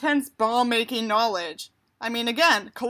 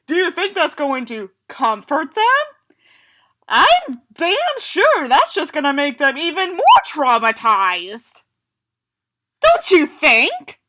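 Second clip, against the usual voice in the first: exasperated scolding; 5 semitones higher, 7 dB louder, slower than usual. Real, not acted. Reader scolds the teacher character.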